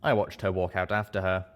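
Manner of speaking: plain reading voice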